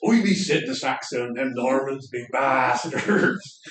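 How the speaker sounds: loud, hammed-up Berkshire yokel accent. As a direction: bellow it like a comic Berkshire Saxon. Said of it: "Berkshire accent".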